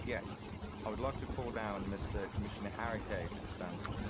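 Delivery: ordinary delivery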